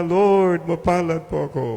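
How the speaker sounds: loud, high joyful proclamation. Shown as a demonstration. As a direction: shout your blessing to the rafters. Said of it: "Louder pa".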